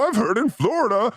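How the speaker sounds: ordinary talk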